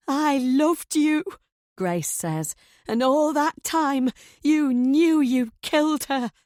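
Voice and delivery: high, loud, slow anguished cry; betrayed wife crying out at her husband; crescendoing. A narrator's reading. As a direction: let the voice break loose in fury. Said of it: "Grace's voice has crescendoed".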